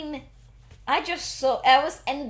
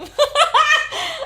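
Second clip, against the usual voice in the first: high, loud laugh; burst of laughter; 8 dB louder than usual. Real, not acted. Reacting to the co-host's film rant, loud real laughter. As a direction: crack up at the rant.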